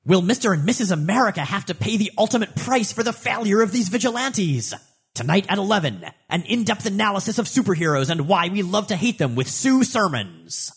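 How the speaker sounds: high, loud sensational news promo voice; TV news anchor. Acted. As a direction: hype it like a tabloid TV teaser. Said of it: "Chuck Chirkle says".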